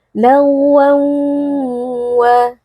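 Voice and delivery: "lagwan wa" with a long nasal hum into the w; prolonged gunnah. Shown as a demonstration. Teacher showing idgham, humming "lagwan" into "wa". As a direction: hold the hum at the end of "lagwan" before "wa". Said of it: "disertai dengan adanya dengung atau gunnah yang dipanjangkan".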